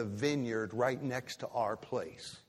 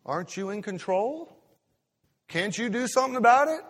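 higher, needling taunt that builds louder; mock devil taunting, building louder; goading devil; 8 semitones higher, 6 dB louder than usual. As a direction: egg them on like the devil, rising to a push. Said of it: "And the devil says".

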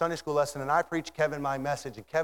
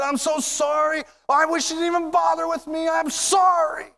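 anguished apology; 13 semitones higher, 6 dB louder than usual. Acted. Damsel apologizing to Paul and Silas, anguished shouted apology. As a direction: cry out the regret, louder and higher.

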